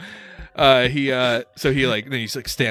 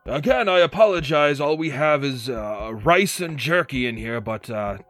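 a bit higher and louder, proudly offering drink; hearty host; 6 semitones higher, 6 dB louder than usual. Acted. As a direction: boast about the spirits with a host's cheer. Wizard serving food to guests.

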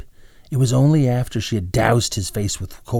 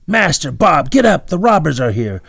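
high loud shout for help; urgent shout; 7 semitones higher, 8 dB louder than usual. A narrator's reading. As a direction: yell the men awake. Calling men to wake.

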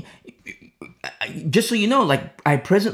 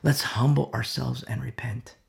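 humble call; 5 semitones lower, 5 dB quieter than usual. Retold or imitated. A humble believer calling the church to repent, softer. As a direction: plead gently for repentance. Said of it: "who says".

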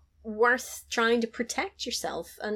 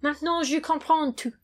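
put-on suave French accent, higher; 9 semitones higher, slower than usual. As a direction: say it like a smooth Frenchman. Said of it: "And he's like".

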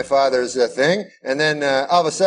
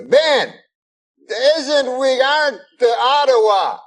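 high, mumbly mock Cosby; Bill Cosby impression; 8 semitones higher, slower than usual. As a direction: pitch up and muddle the words like Cosby. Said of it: "and he goes".

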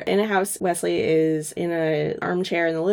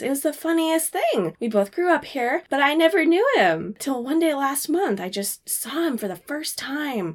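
smitten wife gushing; 7 semitones higher than usual. Acted. Smitten wife gushes how they met.

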